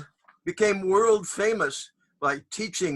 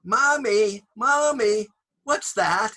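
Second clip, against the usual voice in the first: put-on high, eager little kid; child voice; 6 semitones higher, 6 dB louder, slower than usual. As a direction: ask like a curious small child. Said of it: "I will repeat it for you here".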